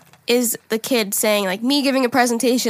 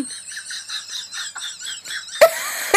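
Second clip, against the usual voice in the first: shrieking laugh; 6 dB quieter than usual. Real, not acted. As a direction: squeal with laughter. Laughing at penguin audio.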